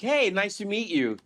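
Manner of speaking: polite, perky greeting; friendly greeting. Retold or imitated. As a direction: greet them like you're pleased to meet them. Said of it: "I couldn't even be like".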